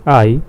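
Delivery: I said as English "eye"; I as the English letter name, gliding "eye". Shown as a demonstration. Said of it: "We say it in English".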